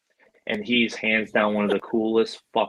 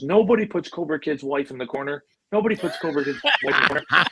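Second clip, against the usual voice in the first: imitated movie star, raised pitch; Vince Vaughn; 5 semitones higher than usual. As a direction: proclaim it as mock chivalry, pitch climbing. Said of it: "just like he is in the movies".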